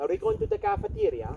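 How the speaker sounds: high-pitched mock Italian question; Italian accent. Mock Italian asking a friend about the cafeteria, high-pitched. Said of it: "talks to each other like Italian".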